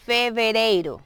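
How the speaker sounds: FEVEREIRO with a careful v; clear Portuguese V. Shown as a demonstration. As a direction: spell out "fevereiro" syllable by syllable for learners. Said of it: "siempre tratar de pronunciar bien la B".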